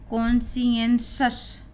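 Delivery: slow, syllable-by-syllable "कॉन्शियंसस", kon-si-en-shus; pronunciation demo. Shown as a demonstration. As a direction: spell out "कॉन्शियंसस" slowly in four beats, ending in shus. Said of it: "तो इसको हम पढ़ेंगे".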